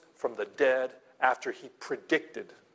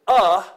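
"A" said much louder and higher; stress on A; 5 semitones higher, 11 dB louder than usual. Shown as a demonstration. Pastor contrasting articles, punches "A" loudly. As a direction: hit "A" hard, set apart. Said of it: "is an indefinite article".

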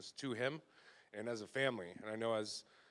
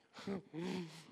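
a quick real chuckle; brief amused laugh; short laugh; 6 semitones higher, 6 dB quieter than usual. Real, not acted. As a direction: laugh at your own joke. Laughs at own joke.

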